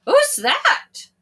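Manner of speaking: very high startled who's that; startled question. Acted. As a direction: ask it alarmed. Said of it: "asked Thomas".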